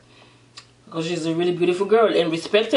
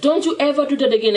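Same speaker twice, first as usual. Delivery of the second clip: raised-pitch indignant scolding; mock angry warning; 6 semitones higher, faster than usual. Woman tells off the prince, retold mildly angry and higher. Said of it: "She got really mad and angry".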